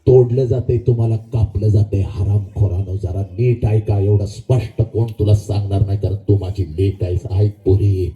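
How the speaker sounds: very low, weighty delivery; grave warning. Real, not acted. Speaker warns his daughters of danger, deep grave warning. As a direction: drop deep and warn them solemnly.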